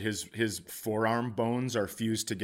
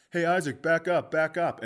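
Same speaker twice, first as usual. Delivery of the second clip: calling out; 5 semitones higher, 4 dB louder than usual. Retold or imitated. Calling out across field.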